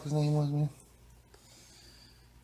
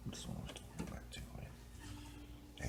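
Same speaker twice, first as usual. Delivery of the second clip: whisper; 11 semitones lower, 26 dB quieter than usual. Pretend.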